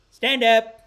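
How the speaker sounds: high, loud command; guru command. Retold or imitated. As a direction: bark it like a healer ordering a miracle.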